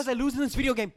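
put-on high, loud whiny French Canadian accent; xQc French Canadian accent. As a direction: whine like a sore loser in a French Canadian accent. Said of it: "his stupid French Canadian accent".

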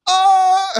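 sudden high yelp; mock startled yell. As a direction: yelp as the punch lands. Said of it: "reacting to it".

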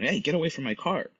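retold angry yell. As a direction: imitate a man yelling someone off.

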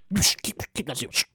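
very soft, low shushing; barely audible, furtive urging; secretive hushing. Acted. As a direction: hush it up under your breath. Hushes the finder secretively.

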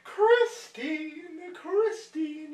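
high, loud, hammed-up crying; imitating a weepy fan; mock tearful wail. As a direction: wail it like an overwhelmed theatregoer. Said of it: "And you're crying".

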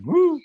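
high, loud sarcastic "woo"; mock celebratory whoop. Disappointed fan on a minor trade, mock cheering whoop. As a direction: whoop as if the small news were huge.

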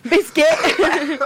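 put-on high Yorkshire accent; Yorkshire accent, hammed-up. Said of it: "In a Yorkshire accent".

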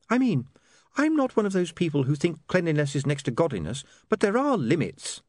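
high, disapproving objection; Saccharissa, disapproving. Acted. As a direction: complain primly in a high voice. Narrator voices Saccharissa.

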